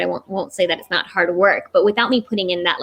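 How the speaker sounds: ordinary talk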